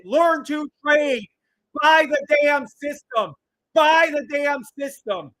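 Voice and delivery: drawn-out, high, passionate shout; impassioned yelling sales push. Real, not acted. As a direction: holler each word like a fired-up pitchman. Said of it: "Why is he yelling at me".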